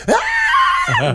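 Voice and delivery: piercing, very high shriek through laughter; very high loud scream imitation, laughing; terrified scream. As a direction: shriek like a girl curled on the floor. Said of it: "just started screaming".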